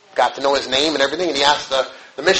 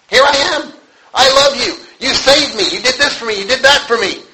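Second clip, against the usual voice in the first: higher, bright rush of thanks; joyful praise; 7 semitones higher than usual. Retold or imitated. Preacher voicing a grateful believer, joyful and higher. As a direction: pour out your thanks to God.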